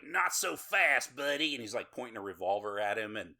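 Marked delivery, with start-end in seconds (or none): 0.0-1.5 slow, louder drawling tough guy. Retold or imitated.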